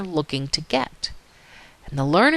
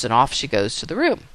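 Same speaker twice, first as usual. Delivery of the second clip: ordinary delivery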